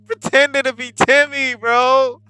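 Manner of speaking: high, loud, incredulous shout; mock outrage. Player objecting to the child character, high outraged shout. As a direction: shout in disbelief, stretching the last words.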